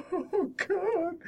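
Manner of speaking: high, teary mock sigh of his wife; imitating wife tearing up. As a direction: imitate a moved wife, a touch tearful. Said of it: "She was crying".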